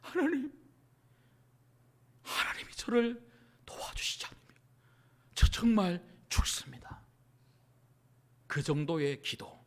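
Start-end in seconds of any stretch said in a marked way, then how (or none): 0.0-7.0 hushed, broken, desperate prayer; gasping plea to God, fading into a whisper; breath nearly gone. Acted. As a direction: plead haltingly, as if about to expire. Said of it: "마치 숨이 넘어갈 듯한".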